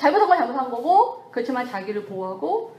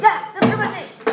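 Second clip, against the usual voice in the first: high, louder laughing reproach; mock scolding; 9 semitones higher, 5 dB louder than usual. Preacher mimics self-reproach, high and laughing. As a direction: wag a finger at yourself. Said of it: "막 이러고".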